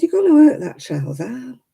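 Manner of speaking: retold telling-off. Retold telling-off of rough mother.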